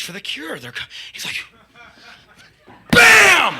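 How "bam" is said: high, very loud "bam"; imitated smash. [name] smashes the footlights, shouted sound effect. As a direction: explode on the word like a blow.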